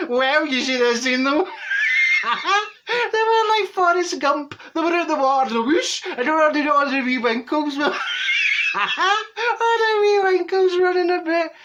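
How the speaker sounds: high, loud, near-crying laughter slowing to the end; helpless laughter. Real, not acted. Telling Phil how the boys ran, in helpless high laughter. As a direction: collapse laughing as you describe it.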